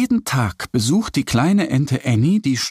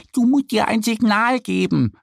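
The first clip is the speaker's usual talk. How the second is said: high duck voice, helpful; patient advice from a friend; explaining little duck; 10 semitones higher than usual. A narrator's reading. Annie explaining to Mo how to start the horse, high duck voice. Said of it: "erklärt Annie".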